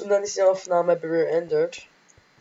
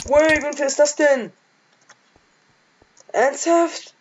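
high squeaky mock outrage; high falsetto complaint; 9 semitones higher, 6 dB louder, slower than usual. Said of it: "So ist die Quietschstimme".

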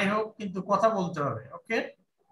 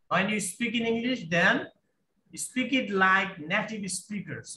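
slow emphasis; much slower than usual. Shown as a demonstration. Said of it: "emphatic slow advice".

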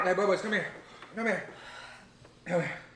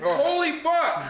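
shocked exclamation; 11 semitones higher, slower than usual. Real, not acted. Burning mouth from hot chip.

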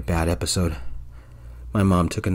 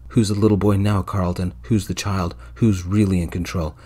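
usual speaking voice

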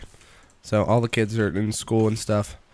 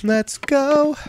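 high-pitched gamer hype; hype cheer. Real, not acted. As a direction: let it rip like you just won. Celebrating a win.

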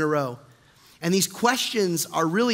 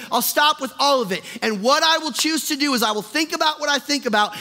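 high, ringing declarations of resolve; full-force preaching; 8 semitones higher, 4 dB louder than usual. Acted. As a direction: proclaim it to the whole church, voice raised.

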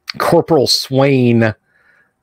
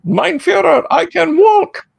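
put-on very high, strained German-accented cry; Doctor Strangelove impression. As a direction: squeal it as a mad German scientist. Quoting Doctor Strangelove's climax.

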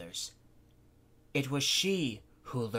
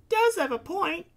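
put-on much higher, light young girl; high girl's voice; 8 dB louder than usual. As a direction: lift it up into a small bright girl's voice. Miyu's voice.

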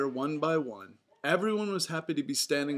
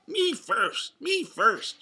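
high, loud, intelligible shout; Donald's shout, clearly worded; 9 semitones higher, 7 dB louder, slower than usual. A narrator's reading. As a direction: repeat the duck's demand in clear words. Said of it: "shouted Donald".

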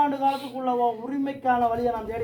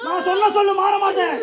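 loud, higher, half laughing vow; ecstatic shouted vow; 6 semitones higher, 3 dB louder than usual. Acted. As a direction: cry out the oath in trance. Deity vows to carry her burden.

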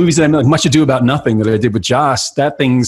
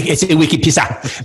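lightly put-on Boston accent, pisser as pissa; a hint of Boston in wicked pisser; 3 semitones higher than usual. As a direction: guest echoes the joke in a light Boston accent. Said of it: "speaking of accents".

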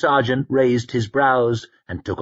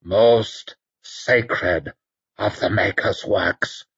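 croaked; slower than usual. A narrator's reading. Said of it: "croaked Werdenur".

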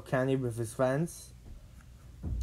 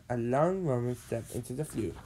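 usual speaking voice

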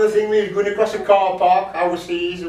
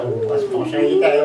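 mock posh; 11 semitones higher than usual. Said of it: "You go".

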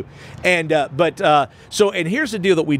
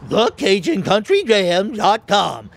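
slow, higher cartoon dog voice; Scooby-Doo voice; 4 semitones higher, slower than usual. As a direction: talk like the Great Dane cartoon dog. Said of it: "Tell us in Scooby".

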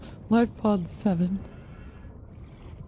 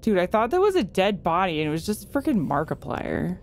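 usual speaking voice

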